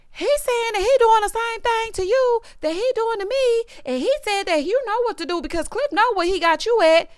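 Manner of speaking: high imitation of Letitia; mock Letitia voice, pitched high; Letitia quoted. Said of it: "Letitia was like".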